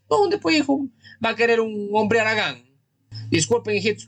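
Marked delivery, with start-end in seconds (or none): 0.1-2.6 scolding outburst. Real, not acted. Scolding lazy caller.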